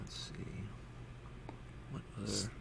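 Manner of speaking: whispered to himself; whispering. Real, not acted. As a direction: breathe it out under the breath. GM muttering while checking rules.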